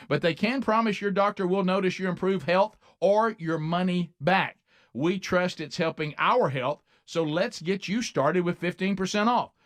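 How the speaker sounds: upbeat radio ad read. Acted. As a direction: sell it like a sponsor spot. Host reads sponsor ad.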